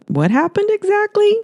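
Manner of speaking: confused news reporter; puzzled reporter. Acted. Hannah puzzled.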